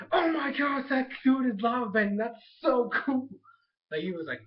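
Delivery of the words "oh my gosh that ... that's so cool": higher, louder gushing delight; thrilled at the villain's lava bending; excited fanboy. Retold or imitated. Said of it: "Bolin's like".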